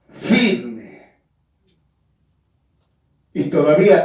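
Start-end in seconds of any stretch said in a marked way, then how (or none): 0.1-1.2 "firme" said low, weighted and deliberate; firm. Shown as a demonstration. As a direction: land "firme" like a verdict. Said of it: "Jesús hablaba firme". ("firme" dicho grave y rotundo)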